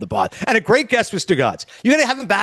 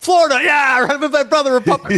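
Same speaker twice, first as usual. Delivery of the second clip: high-pitched giddy cheering, laughing partway; mock elation; 6 semitones higher than usual. Player thrilled to join his brother in Florida, mock elation. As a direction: whoop it up like a player escaping south.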